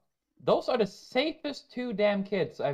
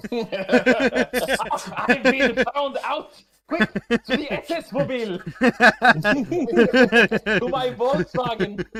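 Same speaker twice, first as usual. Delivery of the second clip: laughing mock panic; 7 semitones higher, 9 dB louder, slower than usual. Mock Nazi escape joke.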